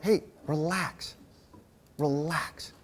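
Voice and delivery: soft, slow settle-down; calming. Retold or imitated. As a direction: hush an upset friend gently, drawing out the word. Calming down an upset friend.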